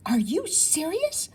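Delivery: slow, awestruck question; with awe. A narrator's reading. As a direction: gasp it out in wonder at the birds. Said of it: "Rue says with awe".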